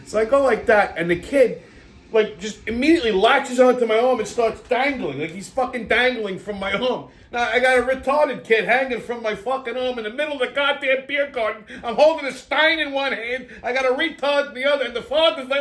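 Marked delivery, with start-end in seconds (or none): none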